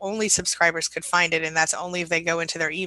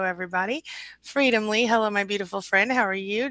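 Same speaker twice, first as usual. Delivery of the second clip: ordinary talk, nothing marked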